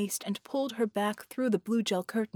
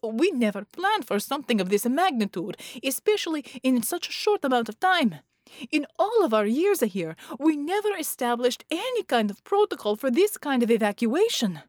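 distressed; 5 semitones higher, 5 dB louder than usual. A narrator's reading. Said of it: "Marconi wailed".

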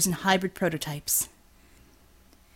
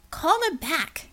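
raised, higher voiced urging; urgent Corby voice; 9 semitones higher, slower than usual. Corby urging the driver, higher pitched, insistent. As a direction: push the driver to turn back.

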